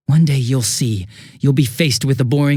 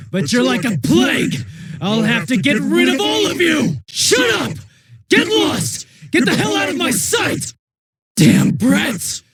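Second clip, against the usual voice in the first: screamed much higher, frantic and unhinged; furious screaming rage; 11 semitones higher than usual. Acted. Cornered killer screaming at the teenagers, unhinged fury. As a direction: lose it completely, shrieking at the brats. Villain raging at the teens in fight.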